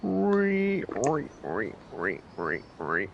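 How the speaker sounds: buzzing insect noise, fading; cicada impression. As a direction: chirr like a cicada. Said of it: "That's my cicada impression".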